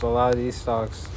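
ordinary delivery

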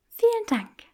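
breathy whisper, pleased; whispered thanks. Acted. Granger thanking Draco for calling her cruel, whispered. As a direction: whisper it back sweetly.

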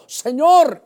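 loud, high desperate call; shouted cry. Acted. Preacher voicing Peter crying to Jesus, shouted. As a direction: cry out loudly to the Lord. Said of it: "Entonces este gritó".

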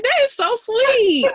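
slow, high, tearful; tearful gush. Retold or imitated. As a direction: stretch it out, moved to tears. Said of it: "and I was like".

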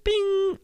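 high-pitched "bing"; ping like an elevator bell; imitated elevator chime.

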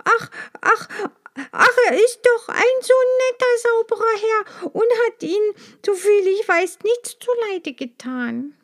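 high, halting bee voice, lost for words; a small bee pleading, frightened; helpless stammer. A narrator's reading. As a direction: stutter out a plea in fright. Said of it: "Maja stotterte hilflos".

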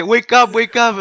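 laughing mock cry to wake him; mock pleading. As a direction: ham up a desperate plea through a laugh. Retold film quote, laughing.

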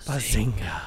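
barely-there low whisper; whispered catchphrase. Acted. Host drops a sitcom catchphrase into the silence, whispered.

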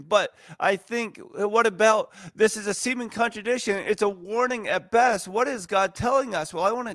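urgent preaching, voice lifted high; rising fervour. Real, not acted. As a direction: climb in pitch as the point catches fire.

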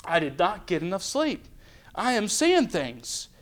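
softly voiced disbelieving inner thought; thinking to oneself. Man in the boat blaming lack of sleep, quietly disbelieving. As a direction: keep it low, a private self-doubting aside. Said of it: "I'd think".

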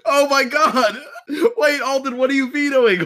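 high, loud disbelieving shriek; shocked. Real, not acted. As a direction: gasp out the shock. Shocked by surprise veto.

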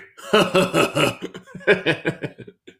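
high, long put-on cackle; exaggerated gleeful laughter; imitated mocking laugh. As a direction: laugh right in someone's face, hammed up. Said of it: "laughed at my face".

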